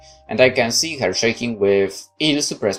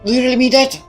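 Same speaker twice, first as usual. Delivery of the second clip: put-on timid young girl voice; 14 semitones higher, much faster than usual. [name] voiced, higher.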